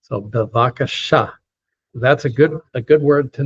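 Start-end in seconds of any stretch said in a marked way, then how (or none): 0.2-1.3 bevakasha said in careful syllables, stress on the final "sha"; teacher's model of bevakasha, end-stressed; last-syllable accent. Shown as a demonstration. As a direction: land firmly on the end of bevakasha. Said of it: "accented on the last syllable".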